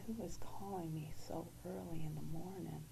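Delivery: hushed, puzzled inner voice; whispered thought. Retold or imitated. Woken by the phone at dawn, she whispers her wondering. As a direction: whisper it like thinking half-asleep.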